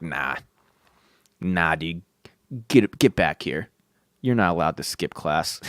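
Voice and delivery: low, quiet stern voice; universe scolding. Retold or imitated. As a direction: scold like a bored cosmic bouncer.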